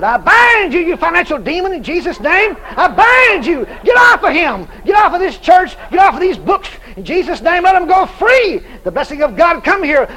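loud, high, forceful rebuke of a demon; commanding rebuke. Retold or imitated. Casting out the financial demon.

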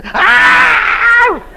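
high loud mock scream of the woman; imitated scream. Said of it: "And her still screaming".

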